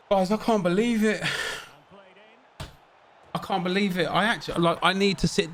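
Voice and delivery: can't believe what just happened; high, stunned disbelief. Real, not acted. Disbelief at the loss.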